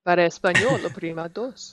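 Spanish gate announcement, crisp; airline gate agent voice. Acted. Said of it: "It's my airline gate agent voice".

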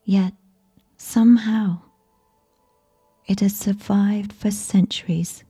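ordinary delivery, nothing marked

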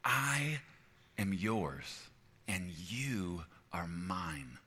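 slow, hushed whisper of devotion; God as loving owner. Acted. God speaking to his children, tender whisper. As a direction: tenderly pledge yourself, very softly. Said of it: "It has that same tone".

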